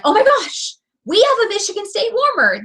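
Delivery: high, thrilled exclamation; excited gush. Retold or imitated. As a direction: squeal with delight at the discovery. Excited seeing the Michigan truck.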